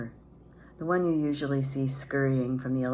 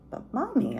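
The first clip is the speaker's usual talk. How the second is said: put-on high, tearful child plea; a little girl crying out to her mother; between tears; 12 semitones higher than usual. A narrator's reading. Child pleading with mother through tears, high. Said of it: "I said between tears".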